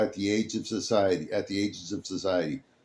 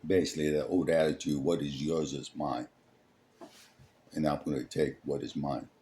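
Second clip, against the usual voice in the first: dropped low and soft, quick aside; quiet aside; 6 semitones lower, 8 dB quieter, faster than usual. Real, not acted. Narrator breaks off to comment, low and quick. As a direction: step out of the story and mutter it.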